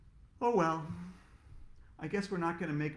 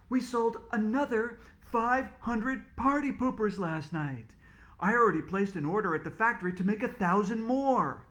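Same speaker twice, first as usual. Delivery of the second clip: proud announcement; 6 semitones higher, 5 dB louder than usual. Acted. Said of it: "she announced".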